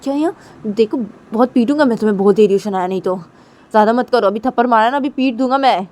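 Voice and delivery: loud, fast threat; threatening scold. Retold or imitated. Tisha threatening to beat Shanaya, loud fast scolding. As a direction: warn her you'll hit her. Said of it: "Tisha बोलती".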